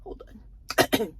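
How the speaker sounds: high, soft, mock-tearful aside; a lightly hammed-up tearful pause; mock holding back tears. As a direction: pretend to choke up. [name] fighting tears.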